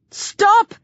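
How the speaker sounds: sharp high-pitched yell. A narrator's reading. Hero to attacking zombies, yelling. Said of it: "I yell".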